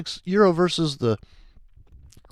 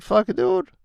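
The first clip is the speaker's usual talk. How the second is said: mock trader; 12 semitones higher than usual. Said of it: "He's like".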